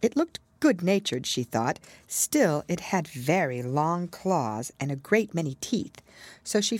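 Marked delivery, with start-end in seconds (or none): none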